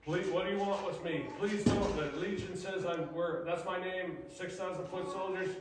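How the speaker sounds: begging possessed man. Retold or imitated. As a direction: beg for mercy, voice pushed up.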